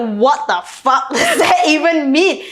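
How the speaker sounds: incredulous and cracking up mid-line; laughing disbelief. Retold or imitated. Answering friends who called him manly, laughing disbelief. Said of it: "I was like".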